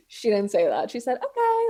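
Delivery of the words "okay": very high little imitation of the analyst; mock agreeable okay. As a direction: chirp it in a put-on high voice. Correcting what the analyst really said.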